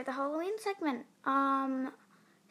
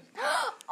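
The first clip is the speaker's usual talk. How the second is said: gasp; 14 semitones higher than usual. Real, not acted. Shocked at forgotten podcast gap.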